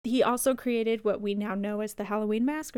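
normal talking voice

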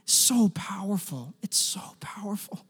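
hushed, soft awe; moved near-whisper. Real, not acted. He reflects on his friend's story, whispering in awe. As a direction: let it fall away almost to a whisper.

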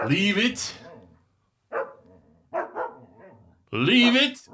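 distant call to the dog; yelling from another room; called from far off. Acted. Calling dog from afar.

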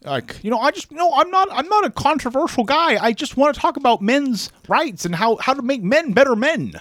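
high, earnest mock Peterson voice; Jordan Peterson impression. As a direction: preach men's rights in the parody voice. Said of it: "you sounded like George Bailey".

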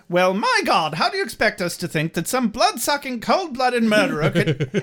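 high-pitched mock outrage, rushing then stretching it out; indignant Wesley. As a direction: act out a scandalized, high-voiced protest. Acting Wesley's indignant outburst.

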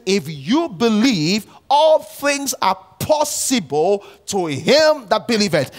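preaching at full force. Acted. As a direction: proclaim it slowly to the congregation. Preaching climax.

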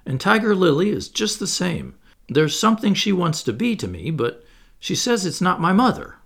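high-pitched, mildly baffled explaining; a touch of bewilderment; puzzled. A narrator's reading. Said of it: "frankly puzzled".

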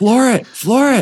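high shout over a revving engine. Retold or imitated. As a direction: yell it over the motor noise. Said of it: "I gotta talk over the engine".

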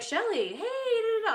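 high, singsong hello; excited greeting. Retold or imitated. Trainers greeting Shelly backstage, excited. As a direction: greet a friend in a high, merry pitch.

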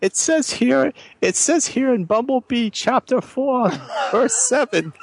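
high-pitched comic recital; mock scripture reader. Reader cites Bumblebee chapter and verse, high mock-scripture voice. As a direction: read out pretend scripture in a high character voice.

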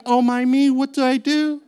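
mock fussy pearl-clutching voice; a hammed-up fretful gasp; clutching pearls in mock alarm. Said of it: "clutching his pearls going".

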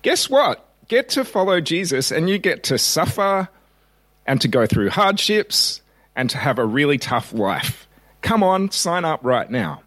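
high, hammed-up salesman voice selling suffering; mock advertisement pitch. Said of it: "our consumeristic advertising would say".

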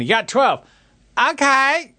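high-pitched mimicry of the relayed message, rising at the end; imitating film repeater. Podcaster re-enacting the clip's back-and-forth relay, high imitation. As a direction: parrot it up high, then lift the last word. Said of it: "the dude who keeps repeating it for everyone".

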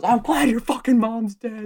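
high, drawn-out retold yell; imitating a comic's furious comeback; losing it. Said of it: "lose your shit on them".

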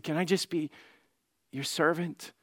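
soft, hushed plea to his father. Retold or imitated. The son quietly begs his dad to let him serve. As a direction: ask it almost under your breath. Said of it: "the son just whispered to dad".

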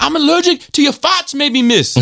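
very high cheeky schoolboy; mimicked school troublemaker. Schoolboy talking back to his teacher, high put-on voice. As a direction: sass back in a squeaky put-on kid voice. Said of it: "He's like".

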